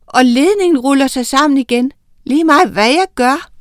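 high, loud and fast alarmed yell; panicked shout. A narrator's reading. Mason shouting that the cord will not obey. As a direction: rattle it off in a panic. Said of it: "råbte Tejl".

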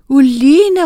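high, loud calling of a name; excited cry; shouted. A narrator's reading. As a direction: call out urgently to her. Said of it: "råbte hun".

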